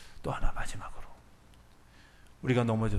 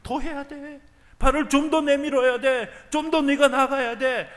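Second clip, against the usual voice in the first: higher, louder urging, slowing at the end; urging voice of the Lord; 7 dB louder than usual. Retold or imitated. The Lord urging believers to step further, insistent. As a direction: press the listener forward, insistent. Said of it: "주님께서 이렇게 얘기하면".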